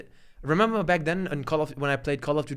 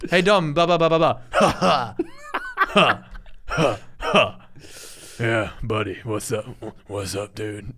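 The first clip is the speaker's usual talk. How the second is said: lower, deep, lazy buddy greeting; Captain Dom voice; 5 semitones lower, slower than usual. Retold or imitated. Host imitating a friend's deep voice to a cohost. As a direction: drop it low like a deep-voiced gamer. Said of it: "That's his voice, dude".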